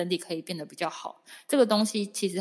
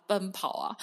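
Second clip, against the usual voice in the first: ordinary delivery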